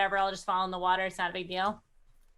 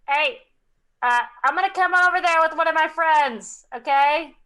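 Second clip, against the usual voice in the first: raised voice over wind; loud, higher, projected to someone far off; calling out; 5 semitones higher, 6 dB louder than usual. Acted. Player telling a distant figure she's coming over, calling out.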